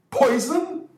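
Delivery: high, startled shout. Acted. Ferguson shouting at Holmes in alarm, high-pitched. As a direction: jolt up at the word. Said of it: "shouted Mister Ferguson".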